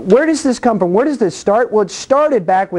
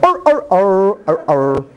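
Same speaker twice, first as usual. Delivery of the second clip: growly chomping arr noises while eating; munch it out like a caveman chewing fruit; Adam's eating sound. Retold or imitated. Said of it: "That's his eating sound".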